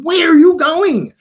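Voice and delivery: loud, high, drawn-out holler; mock-indignant shout, words stretched; calling out. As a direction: imitate someone hollering you back from across the set. Retold actress calling him back.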